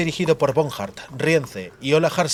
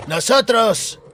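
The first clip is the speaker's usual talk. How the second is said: high, loud indignant howl; 8 semitones higher, 4 dB louder, much slower than usual. A narrator's reading. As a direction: bellow the word in outrage. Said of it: "aulló Dacre Silifant". (aullido indignado, agudo y fuerte)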